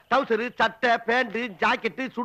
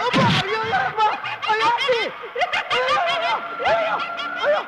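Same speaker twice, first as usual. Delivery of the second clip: high-pitched, frantic wailing; panicked cries; 10 semitones higher than usual. Acted. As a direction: cry out in alarm, pitch climbing. Cries out as machine rises up. (பதறிப் போய் உச்சக் குரலில் அலறல்)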